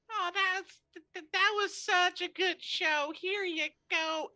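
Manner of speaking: high, slow, doddering praise; sweet old woman. Acted. Old woman handing Ren a coin for the show. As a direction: gush over the show like a granny.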